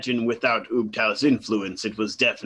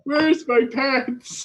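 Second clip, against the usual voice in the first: put-on high, slow doddering old man; a lost old man looking for pants; 13 semitones higher, slower than usual. Player imitates old man.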